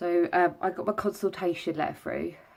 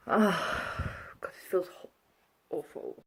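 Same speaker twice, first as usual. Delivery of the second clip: breathless, whispered complaint; pained whisper; slower than usual. Real, not acted. Just out of surgery, telling viewers in a pained whisper. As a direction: let it fall to a pained whisper.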